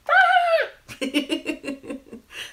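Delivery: loud, high burst of giggling; high giggle. Real, not acted. Storyteller giggling at underpants with children, high giggle.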